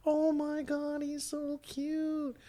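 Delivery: gushing over the kitten. Retold or imitated. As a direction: melt over a cute kitten.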